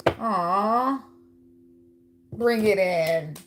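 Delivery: slow, coaxing invitation to a hug. Acted. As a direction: draw them in gently and slowly. Offering a hug to the Virgo.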